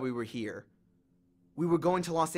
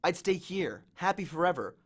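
ordinary delivery, nothing marked

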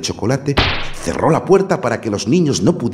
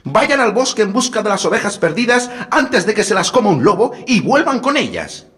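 wicked stepmother=15; 14 semitones higher, 4 dB louder than usual. A narrator's reading. Stepmother keeps scolding.

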